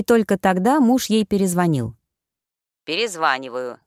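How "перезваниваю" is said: сухо. A narrator's reading. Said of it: "сообщил сухо".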